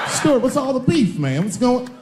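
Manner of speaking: barge in overly friendly; forced cheerful greeting. Retold or imitated. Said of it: "And I come in".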